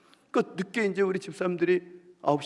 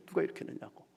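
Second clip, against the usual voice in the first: muttered anger; 3 semitones higher, 7 dB quieter, faster than usual. Retold or imitated. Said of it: "막 화를 내는 거예요".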